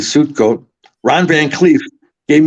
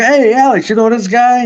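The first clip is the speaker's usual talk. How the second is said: higher, louder call across the club; 11 semitones higher, 4 dB louder than usual. Retold or imitated. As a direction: hail a friend across the room, excited. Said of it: "So I go".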